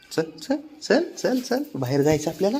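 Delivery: high, soft sing-song coaxing; pet-coaxing call. Real, not acted.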